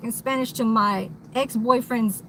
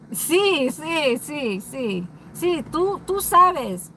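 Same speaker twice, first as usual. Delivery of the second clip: high, punchy street-Spanish "sí, sí, tú sabes"; deep street Spanish; 4 semitones higher, much slower than usual. Shown as a demonstration. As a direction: lay on a thick Spanish street accent. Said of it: "I can even talk really deep down Spanish".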